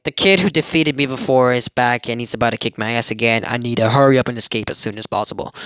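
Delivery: usual speaking voice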